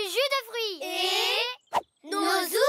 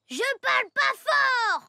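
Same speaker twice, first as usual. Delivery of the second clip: loud denial; 5 semitones higher, 3 dB louder than usual. Acted. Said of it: "tu parles trop fort".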